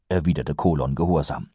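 ordinary delivery, nothing marked

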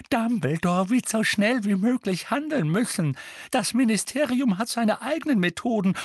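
put-on high, urgent old woman's voice; agitated Mrs. Figg. A narrator's reading. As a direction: explain urgently, high and insistent.

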